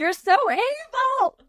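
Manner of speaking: stretched, high pantomime accusation; mock outrage. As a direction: gasp it out like a scandalised fan. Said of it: "you're just like".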